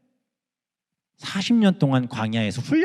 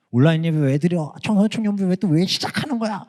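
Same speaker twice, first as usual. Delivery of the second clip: mock whiny complaint, a bit higher; annoyed teen; 5 semitones higher than usual. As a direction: imitate a fed-up teenager grumbling. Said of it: "이렇게 짜증내는 친구들". (짜증내는 청소년 흉내)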